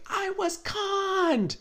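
very high, drawn-out mock wail; Vicky Gunvalson yelling. Quoting a reality star's famous line, mock wail. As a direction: stretch it slow and high like a wail. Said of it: "cue Vicky Gunvalson yelling".

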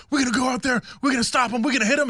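hyped-up teammate. Retold or imitated. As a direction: fire up the team, chanting high. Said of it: "one of his teammates was like".

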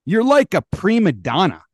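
loud and high prima donna jab; exasperated loud jab; heated jab. Real, not acted. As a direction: call him out loudly. Heated rant.

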